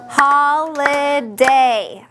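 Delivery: "Holiday" drawn out very slowly, syllable by syllable; clapped-out syllables. Shown as a demonstration. Teacher clapping out syllables for the class, slow and evenly split. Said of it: "clap holiday".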